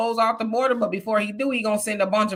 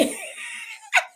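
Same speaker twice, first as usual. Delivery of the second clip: burst of laughter; 6 dB quieter than usual. Real, not acted. Laughing at officers hopping on back.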